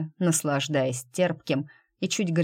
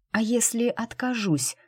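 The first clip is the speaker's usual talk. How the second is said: hoarse hopeful voice; 6 semitones higher than usual. A narrator's reading. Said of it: "услышала девушка собственный голос, хриплый, чужой, с плохо скрытой надеждой".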